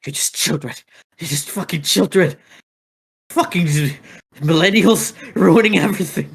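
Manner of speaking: put-on unmasked villain yelling at the meddling kids; Scooby-Doo villain rant. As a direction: spit it out like a cornered cartoon villain. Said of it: "Fucking Scooby-Doo speech over here".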